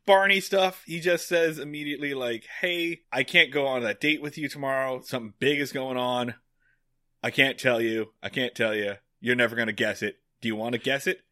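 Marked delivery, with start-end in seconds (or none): none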